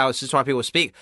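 ordinary talk

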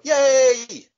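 high, loud mock cheer; imitating a cheering crowd. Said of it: "everyone will go".

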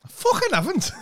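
very high, loud protest; dead high pitch. Real, not acted. Podcaster denying they go high pitch, high-pitched squawk. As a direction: squeal the denial indignantly. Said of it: "you've gone dead high pitch".

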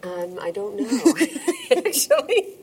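slow start breaking into high laughter; real laugh bursting into the answer. Real, not acted. Award winner asked about her award, laughing as she admits it.

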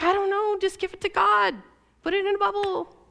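high-pitched, glib imitation of a clueless adviser; mock clueless advice. The wife with no idea what to do, advising me, mock clueless.

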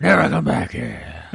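slow, loud devil threat; Satan voice. Retold or imitated. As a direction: warn him off slowly as Satan. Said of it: "Satan sounds like Batman".

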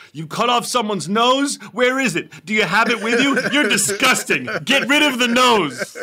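loud, high-pitched disgusted outburst; hammed-up indignant yelling, voice way up; outraged quote. Podcaster acting out Ted's scene from the episode, outraged. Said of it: "and he goes".

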